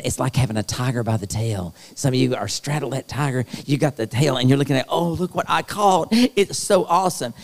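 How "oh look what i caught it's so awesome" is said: mock delighted gloat. Imagined person gloating over tiger.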